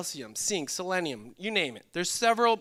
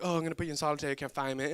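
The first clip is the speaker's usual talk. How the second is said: fast mock movie line; 3 semitones lower, 3 dB quieter, much faster than usual. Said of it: "This is not like your movies".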